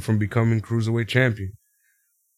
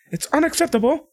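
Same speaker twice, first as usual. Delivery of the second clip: mock outrage; 13 semitones higher than usual. Mimicking [name]'s catchphrase.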